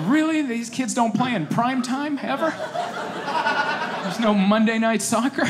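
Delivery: high, laughing disbelief; mock outraged at early games; incredulous. Coach protesting Saturday seven a.m. games, high disbelief. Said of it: "I was like".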